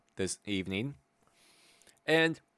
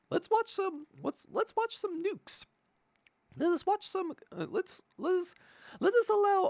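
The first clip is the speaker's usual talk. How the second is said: high sing-song voice. Acted. Introducing the videos.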